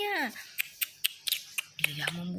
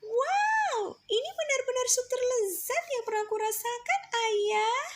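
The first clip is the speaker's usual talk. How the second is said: put-on very high, bright-eyed child voice, faster; delighted child; 13 semitones higher, 3 dB louder than usual. Child praising father's soup, very high excited voice. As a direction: squeal like a kid tasting great soup.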